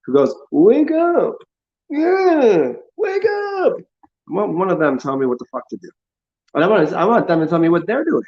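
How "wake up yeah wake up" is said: very high squealed cartoon wail; screechy parody of a wake-up zealot; mocking jackass. Jackass telling everyone to wake up, mocking squeal. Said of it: "one of these jackasses who goes".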